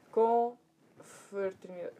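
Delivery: "Confraternidade" said very slowly, part by part; slow sounding-out. Real, not acted. Speaker feeding "Confraternidade" to the translator, very slowly. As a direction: stretch out "Confraternidade" syllable by syllable.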